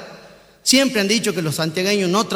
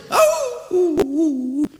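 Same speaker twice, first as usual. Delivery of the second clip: a mock dog howl, drawn out; imitated dog howl; 7 semitones higher, slower than usual. The dog Sultán howling on the sidewalk, a mock howl. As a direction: howl like a lazy old dog. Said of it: "el perro empieza a aullar".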